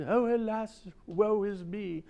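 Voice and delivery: high, overblown woeful wail; melodramatic lament. Shown as a demonstration. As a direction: wail it like an old tragedian. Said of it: "What does this mean?".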